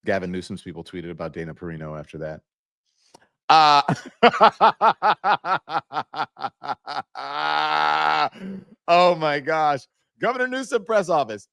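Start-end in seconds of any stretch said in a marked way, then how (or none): 3.9-8.6 high, rising disbelieving laugh; long delighted cackle; burst of laughter. Real, not acted. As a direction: crack up at the tweet. Laughing at the governor's tweet.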